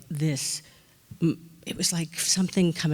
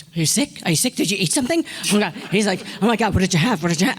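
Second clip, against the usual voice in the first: louder, quick worried questions; a director fussing over a sick actress; alarmed concern; 3 semitones higher, 4 dB louder, much faster than usual. Retold or imitated. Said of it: "he goes".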